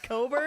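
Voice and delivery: "Coburn" as co-burn, the "ck" left silent; pronounced Coburn. Retold or imitated. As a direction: say "Coburn" with a long o, skipping the k. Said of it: "that's actually pronounced Coburn".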